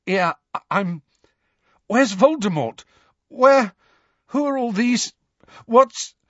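high, halting, disoriented questions; shaken, stammering. A narrator's reading. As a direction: break off each question, badly shaken. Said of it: "shaking so violently he could not".

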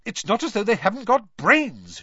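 high, loud, exasperated complaint; fed-up mother's scolding; irritable. A narrator's reading. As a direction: complain crossly, pitch well up. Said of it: "she continued irritably".

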